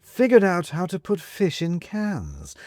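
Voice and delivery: sly cat. Acted. Cat mocking.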